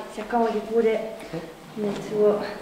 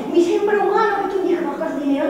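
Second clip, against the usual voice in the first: indignant outburst; 6 semitones higher, 6 dB louder, faster than usual. Acted. Defendant bursts out protesting.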